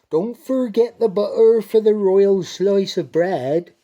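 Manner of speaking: high, loud dairymaid call; dairymaid reminding. Acted. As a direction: call the reminder across to the cow. Dairymaid tells the cow.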